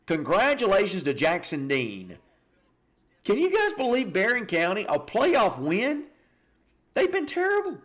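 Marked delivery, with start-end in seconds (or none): 3.2-7.8 excited disbelief. Real, not acted. Excited about an upset win.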